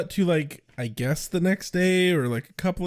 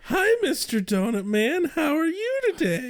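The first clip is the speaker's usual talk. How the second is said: put-on sweet childlike voice; 10 semitones higher than usual. Voicing greeting.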